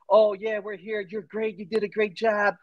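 high mocking sing-song of gentle instructors; mock coddling praise. Recruit describing easy big Army, high mock praise.

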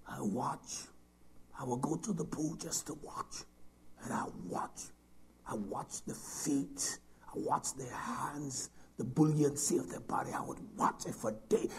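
soft unvoiced sermon whisper; hushed whisper. Preacher recalling watching swimmers, whispered. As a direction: draw them in with a whisper.

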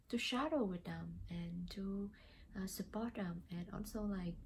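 usual speaking voice

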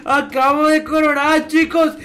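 loud, high, triumphant crowing to the viewers; excited shout. Acted. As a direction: yell it like you just won the lottery. Thrilled he was called mi amor.

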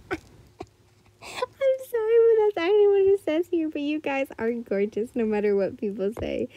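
real laughing fit while talking; helpless laughter. Real, not acted. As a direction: apologize through high, breaking laughter. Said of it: "I'm dying, I'm sorry".

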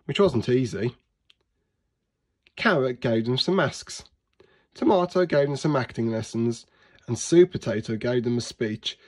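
plain reading voice

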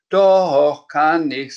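high, loud comeback; defiant retort. Acted. Sams answering Frau Rotkohl's scolding, defiant retort. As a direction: answer back cheekily.

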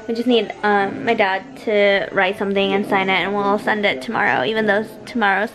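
ordinary delivery

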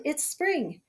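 usual speaking voice

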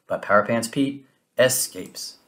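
ordinary delivery

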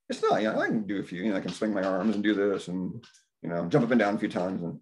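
ordinary talk

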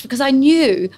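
ordinary talk

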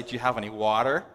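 mock-American pronunciation of "water"; imitated American r in "water"; put-on American accent. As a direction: give in and ask in an exaggerated American accent. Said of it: "So I give in and I say".

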